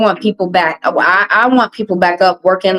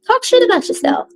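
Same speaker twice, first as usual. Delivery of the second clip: very high mocking falsetto; mocking the bullies in a high squeaky voice; 14 semitones higher than usual. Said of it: "other people will teach you".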